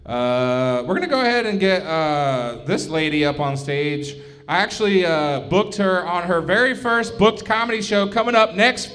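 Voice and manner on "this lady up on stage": ordinary talk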